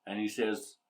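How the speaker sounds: ordinary talk, nothing marked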